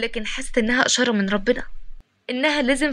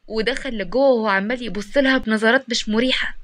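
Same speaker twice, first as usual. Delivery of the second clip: ordinary delivery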